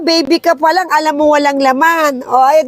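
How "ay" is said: usual speaking voice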